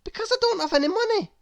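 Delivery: high, fast fretting voice; rushed high fret. Acted. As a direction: gabble it out in a high worried rush. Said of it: "he thought".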